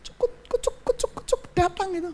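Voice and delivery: small, high, hushed voice; mocking passive hope. Preacher to congregation on waiting idly for blessing, mocking. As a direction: mimic blessings plopping down by themselves, softly and high. Mocks blessings simply dropping in.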